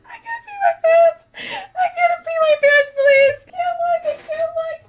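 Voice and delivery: very high, loud, breathless laughing pleas; hysterical laughter. Real, not acted. Streamer begging for help mid laughing fit, hysterical shrieks. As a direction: shriek and plead between laughs.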